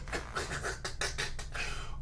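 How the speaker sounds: quiet unvoiced wheezing laugh; soft laughter. Real, not acted. Viewer reacting to silly play calls, soft wheezing laugh. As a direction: laugh under your breath.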